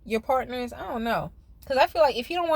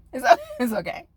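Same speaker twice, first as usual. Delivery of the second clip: laughing it off; 2 semitones higher, 3 dB louder than usual. Real, not acted.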